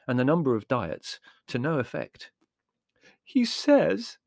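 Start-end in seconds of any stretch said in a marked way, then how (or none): 3.3-4.2 high, loud tearful confiding; high-pitched tearful girl. Acted. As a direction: confide it as if close to tears. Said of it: "Margot confided to us tearfully".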